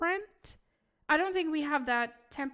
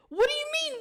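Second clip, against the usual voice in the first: shocked high squeak; 5 dB louder, faster than usual. Real, not acted. Protesting being called fake.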